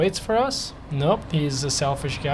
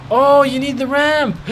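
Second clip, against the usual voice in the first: high, loud cry of alarm; sudden dismayed realization; 10 semitones higher, 6 dB louder than usual. Real, not acted. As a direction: blurt it out high, horrified at the oversight. Realizes he forgot the wheelchair passenger.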